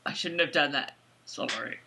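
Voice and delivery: low, soft mock apology; sheepish Claire. Co-host plays Claire regretting the slap, low sheepish mumble. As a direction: drop down into a small, hammed-up apology. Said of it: "how about Claire like this".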